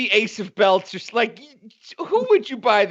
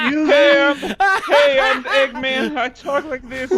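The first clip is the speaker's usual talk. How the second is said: mocking creep voice; lightly put-on voice of a creep; creepy man impression. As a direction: mimic him as a creepy weirdo. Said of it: "He has the voice of a pedophile".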